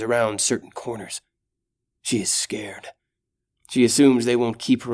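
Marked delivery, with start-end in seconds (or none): 2.0-2.9 hushed narration, whispered. A narrator's reading. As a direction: breathe it, scared. Narrating fear.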